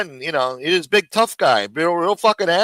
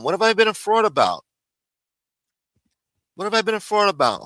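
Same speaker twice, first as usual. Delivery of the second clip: normal talking voice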